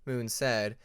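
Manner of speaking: ordinary delivery, nothing marked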